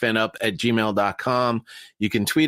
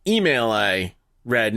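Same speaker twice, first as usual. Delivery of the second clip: ordinary delivery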